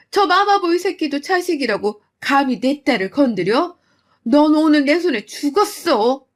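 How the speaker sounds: mother raging at the mother-in-law, loud; furious mother. Acted. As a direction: tear into her for hitting your daughter.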